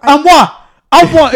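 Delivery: loud, high, offended I'm what; indignant what. Real, not acted. Fires back at friends mocking his word, loud. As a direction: bark it back in disbelief.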